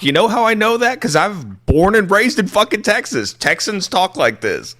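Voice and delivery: high, indignant rant; exasperated insistence. Real, not acted. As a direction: set them straight, worked up. Insisting on real Texan speech.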